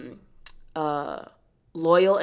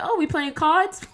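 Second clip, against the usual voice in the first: high sassy quip; mock their sudden switch; 13 semitones higher, 4 dB louder than usual. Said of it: "it'd be like".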